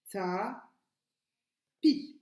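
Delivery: "Ta, pi" in two detached syllables, a pause between; découpage en syllabes. Shown as a demonstration. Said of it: "compter le nombre de syllabes". (« Ta, pi » syllabe par syllabe, bien détachées)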